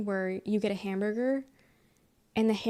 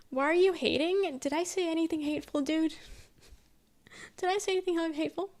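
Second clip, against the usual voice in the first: mock protest; 7 semitones higher than usual. Answers an accusation of hating.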